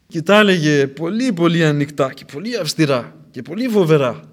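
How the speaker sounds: loud, emphatic sermon voice; forceful preaching. Real, not acted. Preacher stressing John's severity, loud and forceful. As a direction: hammer each word home.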